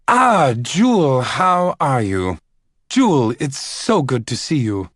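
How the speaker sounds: overdone delight; Caleb greeting warmly. Acted. Caleb greets the older lady loudly, effusive. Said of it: "advancing quickly on the older lady".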